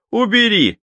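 high sharp command; sharp forbidding shout. Acted. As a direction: stop her with a sharp cry. Fortune teller forbids taking money.

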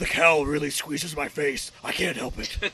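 low, slow whispery rasp; mock strained vigilante voice; Batman. Hero excuses his voice to butler, low rasp. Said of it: "still in the Batman voice".

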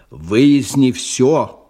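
slow and loud; forceful order. Acted. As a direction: hammer each word of the command. Boss gives order.

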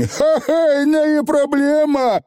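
very high, crowing little voice; proud Feegle boast. A narrator's reading. Feegle showing off to the young witch, proud. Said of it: "гордо выпятил грудь Явор".

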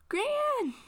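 very high, loud cry; anguished cry. Acted. Green calling after his grandmother, anguished. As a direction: cry out for her.

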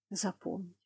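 soft sullen repeat under breath; muttered echo. A narrator's reading. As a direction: echo it to yourself, barely audible. (бормоча себе под нос)

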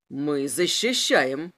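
low, slow drawn-out menace; slow low interrogator; menacing inspector. A narrator's reading. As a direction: draw it out low as a threatening officer. Inspector's line in narrated interrogation.

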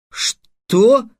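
high, loud, shocked question; stunned. A narrator's reading. Trix hearing the price of three hundred dinars, stunned. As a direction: blurt it out in disbelief. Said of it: "остолбенел Трикс".

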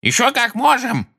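very high, indignant retort; indignant exclamation. A narrator's reading. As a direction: protest hotly in a high voice. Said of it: "негодующе воскликнула миссис Фигг".

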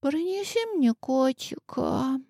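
сонное бормотание, тихо; пробормочи во сне; сквозь сон. Acted. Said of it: "сквозь сон пробормотала она". (сквозь сон)

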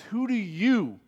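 "you" drawn out with a big pitch swing; pointed question landing squarely on "you"; stress on YOU. Shown as a demonstration. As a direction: lean hard on the word "you". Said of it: "emphasis on you".

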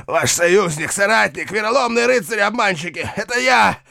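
high frantic calling for help; desperate shouting. Acted. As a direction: scream for help, panicking. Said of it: "изо всех сил звать на помощь".

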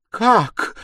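high, loud outraged outburst; sudden cry. A narrator's reading. Stepmother suddenly grasping the accusation, a sharp cry. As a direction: snap out of a daze with a shout. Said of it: "вскрикнула вдруг, опомнившись".

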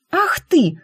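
high indignant cry; outraged cry. A narrator's reading. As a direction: cry out in fake fury. Girl hits him, fake outrage.

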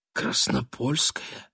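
slow, soft trembling whisper. A narrator's reading. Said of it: "прошептал он дрожащими губами".

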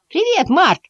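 звонко и громко, очень высоко; крикнуть весело, по-детски тонко; весёлый крик мышат. A narrator's reading. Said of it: "весело крикнули двое мышат". (мышата весело здороваются на бегу)

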